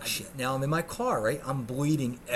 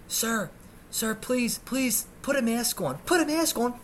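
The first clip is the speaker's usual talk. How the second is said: high voice, growing higher and more urgent; insistent nurse; 9 semitones higher than usual. Retold or imitated. Nurse telling bleeding patient to mask up, high insistent voice. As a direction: imitate a nurse pleading, building in pitch.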